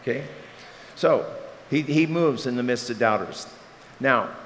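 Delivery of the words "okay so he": ordinary talk, nothing marked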